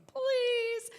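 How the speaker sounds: high, loud, drawn-out begging; exaggerated pleading. Scorpion begs the reluctant frog for a ride, high exaggerated plea. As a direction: beg hard in a mock-desperate high voice.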